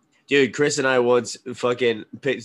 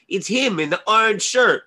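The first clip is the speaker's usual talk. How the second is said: put-on drunk accuser; 9 semitones higher, 4 dB louder than usual. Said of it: "some drunk kid came over and was like".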